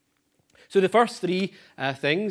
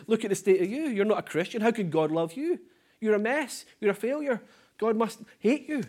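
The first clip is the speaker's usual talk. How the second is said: higher, sneering accusation; accusing enemy; 6 semitones higher than usual. Retold or imitated. Preacher voicing the enemy accusing the sinner, sneering higher. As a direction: jeer at the sinner from a pitch above your own. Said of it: "he points the finger at us and says".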